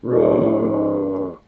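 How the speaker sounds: long, drawn-out imitated roar; voiced animal roar, held long; bear roar. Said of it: "there's roaring in the distance".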